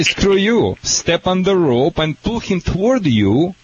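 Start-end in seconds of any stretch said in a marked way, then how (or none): 0.0-0.8 owner firing back. Retold or imitated. Owner answering the dog with a raised, higher voice. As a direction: throw it back at the dog with force. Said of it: "And you say".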